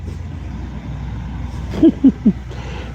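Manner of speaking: long breathy laugh, high and soft. Real, not acted. Laughing at his own dog voice, soft high laugh.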